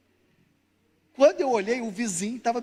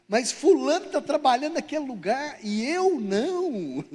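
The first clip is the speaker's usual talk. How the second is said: higher, stretched mock grievance; jealous complaint, hammed-up and slow; envious protest; 5 semitones higher, 3 dB louder, slower than usual. Envious person about another's job, mock indignant.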